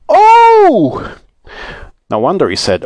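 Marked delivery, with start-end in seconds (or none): 0.1-1.2 long, very high wail; loud drawn-out "oh" of dismay; shriek. A narrator's reading. Saucepan man losing his land, shrieking wail. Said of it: "shrieked the saucepan man".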